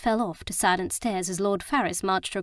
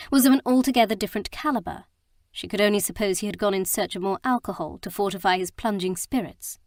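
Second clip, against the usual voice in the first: usual speaking voice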